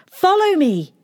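high, loud rallying shout. A narrator's reading. Princess calls to blacksmith and villagers, shouting. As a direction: call out across the village. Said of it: "she shouted to the blacksmith".

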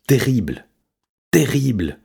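high, panicked cat voice; distressed meowing. A narrator's reading. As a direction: wail it in high alarm. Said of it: "miaula Je sais tout".

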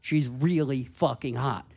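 usual speaking voice

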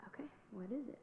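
hushed, breathy question to the doctor; nervous whisper. Retold or imitated. Asking the doctor while mom cries, whispered. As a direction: barely voice it, afraid of the answer.

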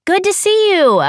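high, loud and glad; excited. A narrator's reading. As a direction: greet him with a burst of joy. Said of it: "I said".

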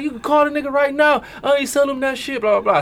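high put-on protesting voice; Tweezy. Friend retelling a challenge to call the buyer, high mimicking voice. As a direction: push the voice way up, imitating his denial. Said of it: "is that your Tweezy voice?".

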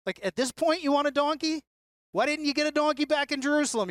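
you can't be serious, quick; playful incredulous question; mock disbelief.

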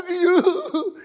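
loud, high wailed answer; mock crying. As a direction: bawl it out in pretend tears. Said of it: "I'm ready to cry again".

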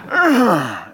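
loud acted grunt of anger; angry outburst grunt. Preacher mimics people physically acting out anger, angry grunt. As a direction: let the anger burst out physically. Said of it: "They're physically going to say".

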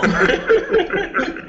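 a real laugh breaking out; burst of laughter. Real, not acted. Laughing at a friend's butter joke, a high burst.

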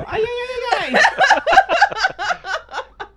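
very high, loud robot wailing; hammed-up cartoon robot cry; Alpha Five. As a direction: squeal it like a panicky little robot. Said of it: "sound like that little robot from Power Rangers".

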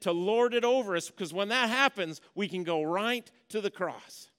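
loud, high, full-force preaching. Acted. Pastor urging the church to drop their sin, preaching hard. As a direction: press the point home to the congregation.

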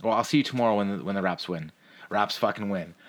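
normal talking voice

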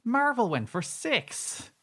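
incredulous, high-pitched and drawn out; high, slow disbelief at the figure; mini rant. Real, not acted. Cohost ranting about takeover prices, incredulous. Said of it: "Mini rant over".

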